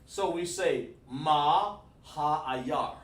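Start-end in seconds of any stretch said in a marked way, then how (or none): 1.1-2.9 "Ma ha ayar" drawn out very slowly, louder; teacher sounding out "Ma ha ayar" word by word; slow pronunciation demo. Shown as a demonstration. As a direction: stretch each word of "Ma ha ayar" for learners. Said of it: "that's how you said that".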